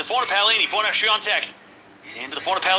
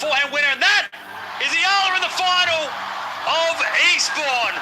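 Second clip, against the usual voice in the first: excited call; 8 semitones higher than usual. Real, not acted. Streamer calling Ayala's winning shot to chat, rising excitement. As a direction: ride the winner up into a big burst of excitement. Match point won, calling it.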